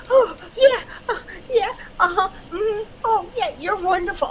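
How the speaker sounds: overwhelmed fangirl squeal, half crying; starstruck gushing. Retold or imitated. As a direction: gush like a fan meeting her idol. Starstruck meeting her idol.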